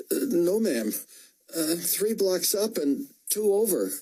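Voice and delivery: high, hurried flustered private; nervous soldier. A narrator's reading. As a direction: stammer out directions to the officer. Nervous soldier gives directions.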